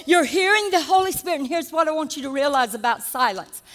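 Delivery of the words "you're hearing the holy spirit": preaching at full force. Acted.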